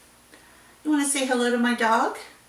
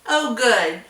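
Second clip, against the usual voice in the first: ordinary talk, nothing marked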